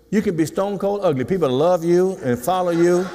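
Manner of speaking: ordinary talk, nothing marked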